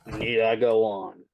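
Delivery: normal talking voice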